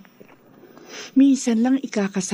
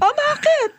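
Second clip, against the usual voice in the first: mataray na bakit. Acted. Mother challenges son.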